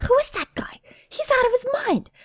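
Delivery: high-pitched amazed onlooker; astonished exclaiming. Acted. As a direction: exclaim in awe at a madman. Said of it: "another exclaims".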